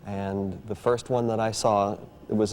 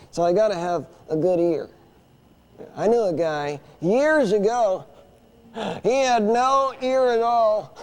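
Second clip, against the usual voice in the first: puppet voice; 10 semitones higher, 3 dB louder than usual. Acted. Dummy Orson telling the audience a joke, high louder voice. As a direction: tell the gag as the puppet.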